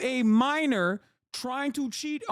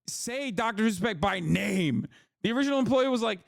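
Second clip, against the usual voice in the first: ordinary talk, nothing marked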